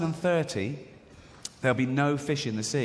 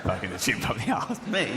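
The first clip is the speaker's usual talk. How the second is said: lower, laughing disbelief with a rising end; incredulous repeat; 4 semitones lower than usual. Retold or imitated. Patient echoing the doctor's diagnosis, laughing disbelief. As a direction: echo it back as if you can't believe it.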